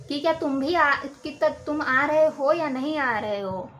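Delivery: ordinary talk, nothing marked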